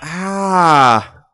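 drawn-out loud "ah"; sustained shout; loud yell. Real, not acted. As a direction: yell out on one long vowel. Hit during gameplay.

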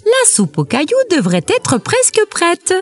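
voix de poule haut perchée; mère poule autoritaire. A narrator's reading. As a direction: demande un service, dans l'aigu. La poule rassure le loup. (poule de conte à la voix aiguë)

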